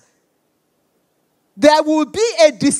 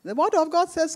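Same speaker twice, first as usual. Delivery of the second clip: ordinary delivery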